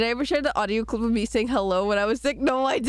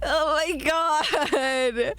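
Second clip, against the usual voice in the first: slow, high, drawn-out groan; mortified laughing; 5 semitones higher, much slower than usual. Real, not acted. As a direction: drag it out in embarrassment. Mortified she was muted.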